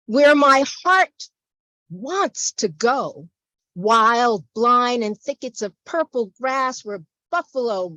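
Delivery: measured poem reading with strong, punched openings; poetry recitation. Acted. Poet performing a poem for a library audience, declaimed. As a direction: declaim the poem, lingering between phrases. Reads own poem aloud.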